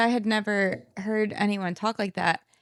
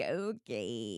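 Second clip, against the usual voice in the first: unimpressed; 10 semitones lower, 10 dB quieter than usual. Retold or imitated. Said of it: "I'm like".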